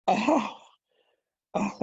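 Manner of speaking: a sharp, high, loud gasping "oh"; stunned gasp. Retold or imitated. Mentor floored by a mentee's idea, gasping in surprise. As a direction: catch your breath, struck by the idea. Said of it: "the suck of air that I was doing".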